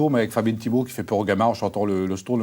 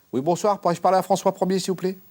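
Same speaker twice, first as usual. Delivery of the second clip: high, bright phone manner; polite phone voice; 9 semitones higher than usual. Acted. Medium on the phone to the beyond, high and polite. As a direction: ask for the king like a switchboard.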